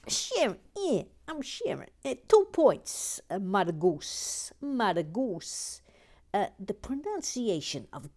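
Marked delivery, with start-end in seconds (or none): 0.1-5.8 put-on Brooklyn accent. Host reading Sharon's comment in mock Brooklyn accent. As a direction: read her comment as a brash Brooklyn lady. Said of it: "enjoy doing your Brooklyn accent".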